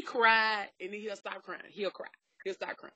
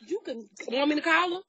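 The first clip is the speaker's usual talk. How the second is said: ordinary talk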